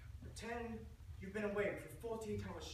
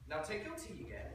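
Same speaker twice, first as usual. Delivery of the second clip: ordinary talk, nothing marked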